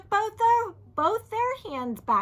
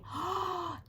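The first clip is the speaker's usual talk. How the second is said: quick higher gasp; surprised gasp; 6 semitones higher, 7 dB quieter than usual. Real, not acted. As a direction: gasp with delight. Gasp at caps falling.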